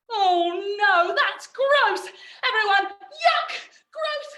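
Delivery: hammed-up disgust. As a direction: recoil from something filthy. Mock disgust at toilet brush.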